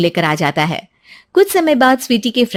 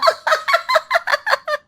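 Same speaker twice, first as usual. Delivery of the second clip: high, triumphant villain cackle; evil laugh. Acted. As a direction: cackle over the plan's success. Villain laughs after her plan.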